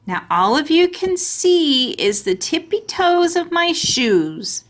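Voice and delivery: put-on delighted kid showing off; very happy. A narrator's reading. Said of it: "I said, very happy".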